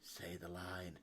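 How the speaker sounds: quiet prompting; hushed. Pretend. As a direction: murmur it like a tease. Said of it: "Jericho's gonna get upset".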